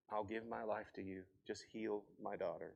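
retold prayer, soft and low; tender prayer. Mother calls on the Lord for her daughter, tenderly. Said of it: "In a tender moment".

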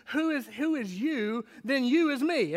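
ordinary talk

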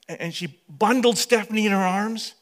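normal talking voice

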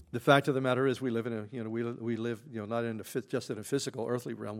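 ordinary talk, nothing marked

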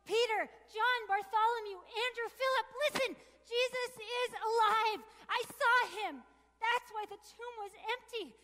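loud, high, breathless shout; Mary shouting to the disciples that Jesus is alive, loud and high; calling out the news. Acted. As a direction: announce it joyfully to everyone.

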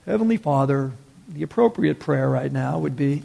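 mock a stiffly proper prayer; proper formal prayer. Preacher mocks appropriate prayer to Heavenly Father, subdued formal tone. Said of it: "Rather than go".

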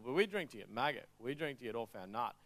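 ordinary delivery

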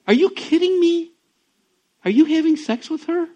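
high, loud disbelieving throw-back; incredulous echo. Retold or imitated. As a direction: toss the question back in disbelief. Friend echoes the question back.

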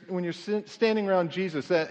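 normal talking voice

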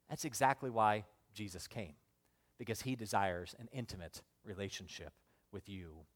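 low, soft sermon delivery; hushed pastoral assurance. Real, not acted. Preacher to those not right with God, hushed. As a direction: drop right down and reassure them.

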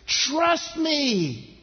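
high, emphatic command to trust; urgent preacher voicing God; emphatic insistence. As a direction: insist with force, pitched well up. God insists over people's objections.

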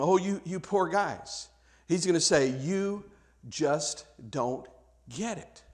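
Jesus pitying the Pharisees, soft and tender; gentle pity. Retold or imitated. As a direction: say it gently, fading almost to nothing.

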